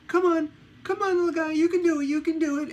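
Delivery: very high, loud, pleading coax; coaxing the dog. Retold or imitated. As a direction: coo at a scared little dog to come down. Owner coaxing dog down stairs.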